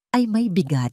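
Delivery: ordinary talk, nothing marked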